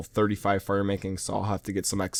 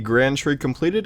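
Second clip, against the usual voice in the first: ordinary talk, nothing marked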